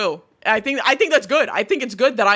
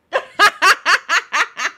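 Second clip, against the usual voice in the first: giggling laugh; 9 semitones higher, 6 dB louder than usual. Real, not acted. Laughing at friend.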